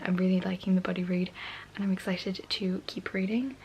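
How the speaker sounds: late-night whisper; hushed night-time vlog whisper. Real, not acted. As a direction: whisper so as not to wake anyone.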